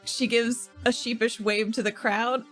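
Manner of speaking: usual speaking voice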